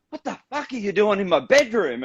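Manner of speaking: high, startled outburst; shocked confusion. Retold or imitated. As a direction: play the groggy mate waking up alarmed. Fainted mate wakes, confused.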